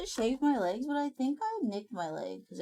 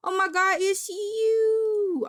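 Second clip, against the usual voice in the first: high, loud squeal of delight; excited recognition; 11 semitones higher, 6 dB louder, slower than usual. Real, not acted. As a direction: burst out as if spotting a favourite person. Excitedly spotting someone she knows.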